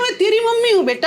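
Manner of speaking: mother pleading at the ghat, voice pitched high; desperate plea to son. Retold or imitated. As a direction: beg the boy to recognise her.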